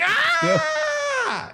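high screech of a burned man; pained yelp. Acted. Farmer yelps as purple fire hits his rear end.

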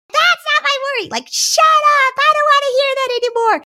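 very high mock child tantrum; hammed-up child's outburst, high and loud; angry kid. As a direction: put on a squealing, furious kid talking back. Voicing an angry kid talking back.

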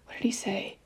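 unvoiced whisper to herself; whispered aside. Real, not acted. Mother to herself, recalling the boy's words, in a whisper. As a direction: whisper it as if trying to remember.

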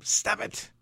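storyteller acting an exasperated shout; yelling. A narrator's reading. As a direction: yell at noisy children to stop. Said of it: "he yells at them".

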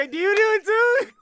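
thrilled to find a friend does it too; excited. Real, not acted. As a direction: squeal the question in delight. Excited recognition of shared habit.

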